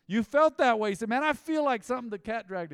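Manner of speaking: loud, high preaching burst. Acted. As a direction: belt it out to the congregation. Preacher builds to loud climax.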